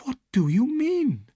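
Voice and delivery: startled high question; surprised. Acted. Young woman startled by Holmes, surprised. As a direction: caught off guard, asking. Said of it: "asked Miss Stoner in surprise".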